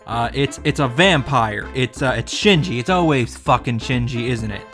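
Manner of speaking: ordinary delivery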